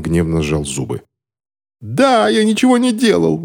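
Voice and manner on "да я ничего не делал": very high-pitched wail of innocence; yelping protest. A narrator's reading. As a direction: yelp it out, pitched far above normal. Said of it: "завопил мужчина в фетровой шляпе".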